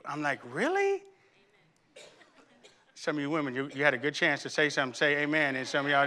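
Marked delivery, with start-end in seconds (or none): 0.5-1.0 high rising, disbelieving question; incredulous. Retold or imitated. Husband doubts wife about the trash, incredulous. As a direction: squeak it upward in soft disbelief. Retold disbelief at wife's answer.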